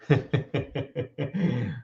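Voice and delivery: long run of quick, quiet sniffs; playacted searching sniffs; mock sniffing. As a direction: sniff around in puzzlement. [name] tries sniffing his own eyes.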